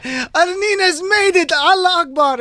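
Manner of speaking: high, stretched-out celebratory cry; hammed-up cry of triumph; mock jubilant cheer. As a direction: whoop it like a rejoicing crowd. Imagined crowd celebrating in Ramallah.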